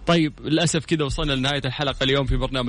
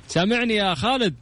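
raised voice; 8 semitones higher than usual. Real, not acted. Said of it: "علي صوتك معليش".

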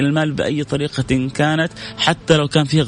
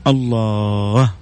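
awed; 2 semitones lower, slower than usual. Real, not acted. Awed repeated exclamation.

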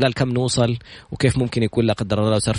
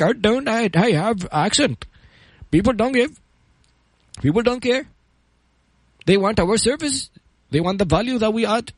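high-pitched imitation of Bengali-accented English; 8 semitones higher, much slower than usual. Speaker retelling a Bengali immigrant's words to inspire listeners, imitated Bengali accent. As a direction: put on a Bengali accent, slowing right down towards the end. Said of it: "هذا البنغالي اللي ما كان عارف يدخل أمريكا".

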